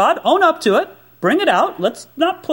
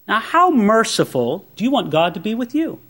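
ordinary talk, nothing marked

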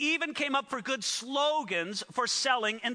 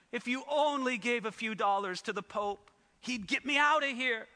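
ordinary talk, nothing marked

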